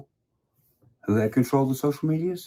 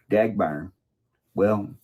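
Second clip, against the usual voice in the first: usual speaking voice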